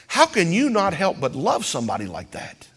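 ordinary talk, nothing marked

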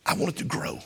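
whispered, intimate wish; hushed whisper; whispered plea. Real, not acted. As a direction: breathe it out to the congregation. Preacher drops to whisper.

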